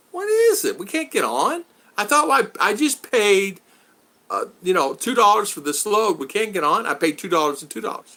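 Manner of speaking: replaying his own exasperation at the stalled stream, high and urgent; frustrated. To his wife when the stream won't load, high frustrated complaint. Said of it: "I go".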